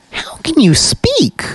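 high startled question. A narrator's reading. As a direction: blurt it out in shock. Startled by talking beak.

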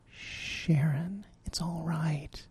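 hushed, breathy comfort; gentle whispered reassurance; soft whisper. A narrator's reading. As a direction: whisper it close to a crying friend. Frog comforts crying Sharon.

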